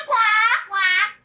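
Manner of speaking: loud, high duck quack; tickled duck, loud and high-pitched; uproarious quacking. A narrator's reading. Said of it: "quacked uproariously".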